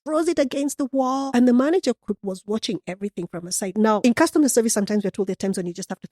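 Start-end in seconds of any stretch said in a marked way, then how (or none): 0.0-0.8 high, slow build to the outburst; excited telling. A narrator's reading. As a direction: raise the pitch as the anger climbs. Building the angry customer story.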